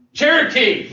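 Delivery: called out high and loud; loud guess. Acted. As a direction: shout the guess like a quiz answer. Teacher shouts a guess.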